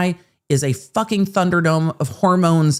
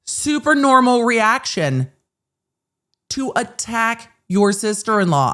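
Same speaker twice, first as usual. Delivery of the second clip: higher, slow mock-approving sarcasm; sarcastic; 6 semitones higher than usual. Real, not acted. Host on attacking a sister-in-law, sarcastic. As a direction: stretch it out with heavy sarcasm. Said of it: "I am being sarcastic and facetious".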